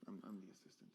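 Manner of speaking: low, hushed, halting; reluctant admission. Shown as a demonstration. Magician demotes himself to assistant, reluctantly. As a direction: barely get the confession out. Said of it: "almost like I don't wanna say it".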